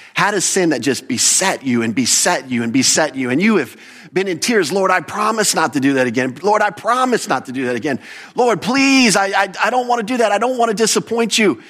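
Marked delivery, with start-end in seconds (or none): 4.7-11.6 acted desperate plea, building higher; pleading prayer. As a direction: beg the Lord, growing more urgent and high-pitched. Acting a tearful believer's pleading prayer.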